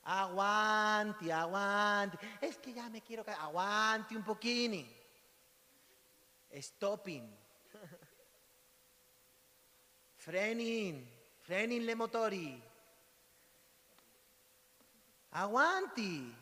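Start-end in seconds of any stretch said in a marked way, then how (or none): 0.0-2.2 loud, drawn-out fake Italian, stretching each aguanti; hammed-up Italian plea to hold on; mock Italian accent. Preacher mocks impatience in fake Italian. 3.4-16.3 loud, drawn-out fake Italian, stretching each aguanti; hammed-up Italian plea to hold on; mock Italian accent.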